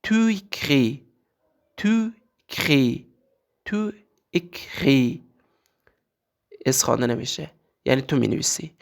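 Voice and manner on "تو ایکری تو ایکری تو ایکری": "تو ایکری" repeated slowly, s silent; slow teacher model of "تو ایکری"; French pronunciation drill. Shown as a demonstration.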